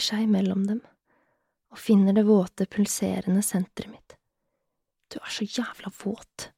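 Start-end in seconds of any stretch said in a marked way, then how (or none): 5.1-6.5 slow, very soft whispered mumble; mumbled. A narrator's reading. Man close to the woman, whispered mumble. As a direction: murmur it slowly under your breath. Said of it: "mumler han".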